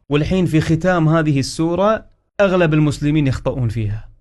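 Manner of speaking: normal talking voice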